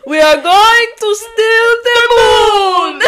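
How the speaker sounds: high, loud, slow villain boast; Despicable Me villain voice. Acted. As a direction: boast grandly like a cartoon villain. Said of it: "I have no more Despicable Me".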